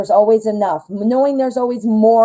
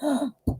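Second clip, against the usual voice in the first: sharp delighted intake of breath; excited gasp; 5 semitones higher, 9 dB quieter than usual. Real, not acted. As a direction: gasp at the good news. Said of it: "I'm so excited for you".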